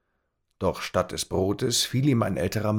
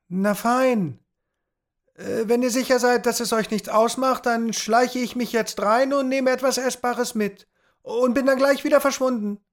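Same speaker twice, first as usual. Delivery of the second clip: put-on nervous boy; 4 dB louder than usual. A narrator's reading. Tom voiced, nervous chatter.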